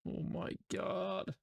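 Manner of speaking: breathy whispered oh my God, high and slow; stunned whisper. Real, not acted. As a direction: gasp it out under your breath. Stunned by the cost.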